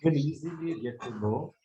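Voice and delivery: barely audible, far from the mic; very low voice. Real, not acted. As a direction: voice fading almost to nothing. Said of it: "Sir, your voice is, uh, very low".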